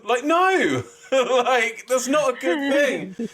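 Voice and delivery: half-laughing outburst, pitch way up; exasperated. Real, not acted. Excited exasperated rant.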